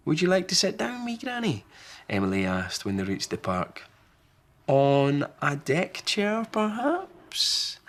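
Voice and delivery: put-on high, eager little girl voice; a polite child offering a seat; high child voice asking kindly; young girl Emily. Emily asks wee Granny.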